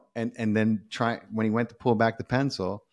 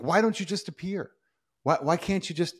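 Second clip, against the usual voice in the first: higher-pitched imitation of a puzzled questioner; mock pleading question; 8 semitones higher than usual. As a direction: pitch it up, pressing God for an answer. Voices questioner challenging God.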